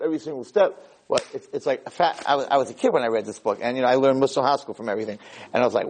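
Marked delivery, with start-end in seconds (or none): none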